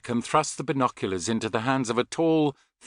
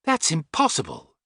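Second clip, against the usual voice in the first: higher-pitched dismissive retort; sharp retort; 5 semitones higher than usual. A narrator's reading. Young woman answering Tony after silence, retorting. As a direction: retort it in firm disbelief. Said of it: "she retorted".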